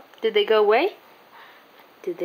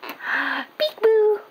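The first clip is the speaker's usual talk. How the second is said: playful peekaboo; 7 semitones higher, much slower than usual. Real, not acted. Peekaboo game with baby.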